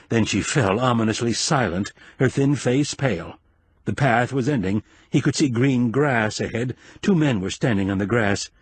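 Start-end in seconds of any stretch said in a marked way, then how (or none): none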